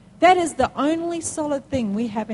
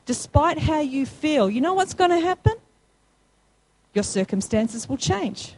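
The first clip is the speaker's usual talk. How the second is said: usual speaking voice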